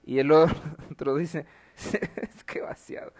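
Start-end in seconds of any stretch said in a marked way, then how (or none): 1.8-3.1 breathless giggling whisper, voice gone; whispered through laughter. Real, not acted. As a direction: giggle the words out without voice. Laughing while reading meme text. (susurro entre risas)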